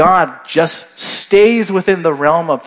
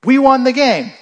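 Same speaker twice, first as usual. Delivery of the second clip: proud cheer; 9 semitones higher, 4 dB louder than usual. Retold or imitated. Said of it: "you could legitimately say".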